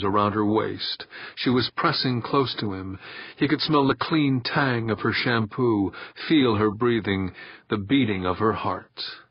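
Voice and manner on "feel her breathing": ordinary delivery, nothing marked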